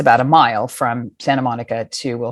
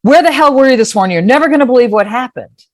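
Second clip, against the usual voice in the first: high, loud retold outburst; worked-up, high-pitched phone rant; word vomiting; 9 semitones higher, 6 dB louder than usual. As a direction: launch in without letting them speak. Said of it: "I launch into".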